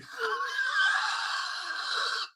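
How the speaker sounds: breathless squeal of laughter; high shrieking laugh. Real, not acted. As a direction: cackle way up high. Laughing at his own story.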